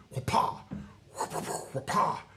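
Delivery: sail flapping in wind; flapping sail noise. Shown as a demonstration. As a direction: imitate cloth snapping in wind. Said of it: "a buffeting sound".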